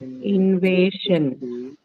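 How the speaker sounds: INVASION drawn out very slowly, syllable by syllable. Shown as a demonstration. Teacher helping student find the stress, Invasion said slowly in syllables. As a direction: stretch out each syllable of Invasion.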